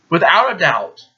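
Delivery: ordinary delivery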